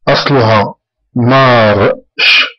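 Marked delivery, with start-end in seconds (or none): 1.2-2.5 مارش said slowly with a clear sh sound at the end; slow model of مارش; careful pronunciation. Shown as a demonstration. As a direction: stress the final sh in مارش. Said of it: "سي اش هي الشين".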